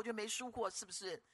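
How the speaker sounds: usual speaking voice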